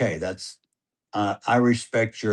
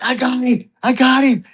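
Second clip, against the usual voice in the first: much higher, louder, giddy; excited; 6 dB louder than usual. Retold or imitated. Son telling his dad he hit the hog, high and thrilled. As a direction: blurt it out thrilled with the kill. Son's excitement after the kill.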